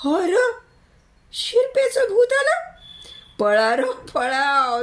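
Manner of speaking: very high panicked cry; terrified shriek. A narrator's reading. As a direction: shriek in fright at the ghost. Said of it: "समद्यांची बोबडीच वळली". (भूत समजून घाबरलेल्या मंडळींची किंचाळी)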